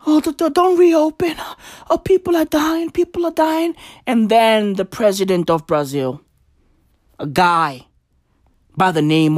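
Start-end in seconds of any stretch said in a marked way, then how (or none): 0.1-3.7 high whining imitation of panicked people; mocking the people crying to stay closed; mock whiny crier. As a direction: whimper it like a crybaby. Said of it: "Motherfuckers out there crying".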